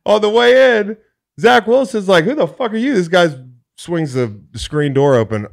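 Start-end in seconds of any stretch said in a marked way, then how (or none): 0.1-2.9 high, loud and fast; excited. Real, not acted. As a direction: burst out excitedly. Excited storytelling peak.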